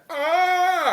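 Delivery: a high, put-on shriek as the queen vanishes; mock villain scream. Recapping the queen's end, a mock high scream. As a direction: yelp it out like a cartoon villain. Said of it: "she's like".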